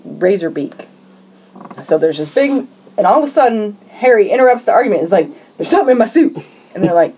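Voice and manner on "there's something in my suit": higher-pitched mock alarm; retold freak-out. Harry interrupting the argument over his suit, mock alarm. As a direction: act out a man starting to panic. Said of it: "he's freaking out".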